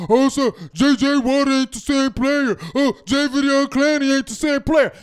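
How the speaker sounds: put-on voice parroting the critics; mocking quote. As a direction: mimic whining commenters. Mocks what page commenters write.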